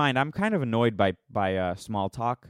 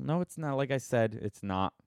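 usual speaking voice

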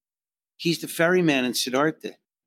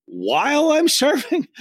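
high, drawn-out disbelieving question; incredulous; 4 dB louder, slower than usual. Retold or imitated. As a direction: squeak it out in disbelief. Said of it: "I was like".

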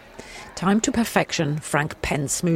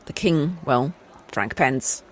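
normal talking voice

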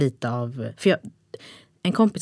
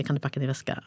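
a second, quieter ask to pack the bag; softer repeated nag; 5 semitones lower, 8 dB quieter than usual. Retold or imitated. To her partner about the bag, a softer repeated nag. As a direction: repeat it lower and softer, tired of asking. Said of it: "jag var så här".

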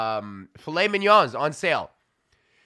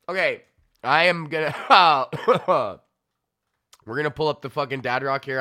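ordinary talk

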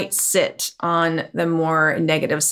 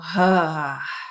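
noisy sigh; 2 semitones lower than usual. Shown as a demonstration. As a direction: sigh it all out with noise. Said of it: "Just make some noise, let it all go".

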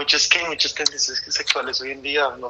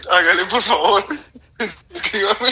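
laughing; 5 semitones higher than usual. Real, not acted. Pleading while laughing.

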